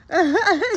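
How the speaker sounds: sudden bright laugh out loud; high burst of laughter. Real, not acted. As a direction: let out a quick high laugh. Dog makes her laugh.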